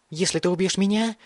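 high-pitched desperate outburst; crazed cry. A narrator's reading. Mad boy confronts the archer aiming at him, crazed cry. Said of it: "крикнул Бен".

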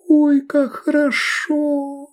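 high, slow sultry moan; moaning woman. A narrator's reading. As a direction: moan it with pleasure. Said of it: "простонала Овидия".

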